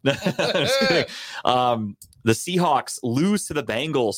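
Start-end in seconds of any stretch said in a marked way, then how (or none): 0.0-1.0 laughing. Real, not acted. After yelling at Tim.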